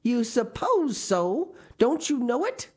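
high, loud, exasperated challenge; indignant. A narrator's reading. Marilla challenges Matthew's half-hearted agreement, indignant. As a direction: throw the words back, drawn out and high.

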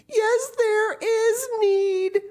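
put-on very high, loud, slow child; tearful protest. A narrator's reading. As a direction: insist through tears.

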